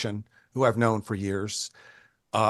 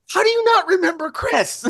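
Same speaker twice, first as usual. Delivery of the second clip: high, loud, laughing incredulity; imitated indignant outburst; mock outrage; 7 dB louder than usual. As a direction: scold a friend in amused disbelief. Imitates Perkins scolding Dave in disbelief.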